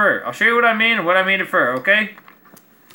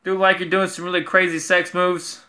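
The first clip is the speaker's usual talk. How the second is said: normal talking voice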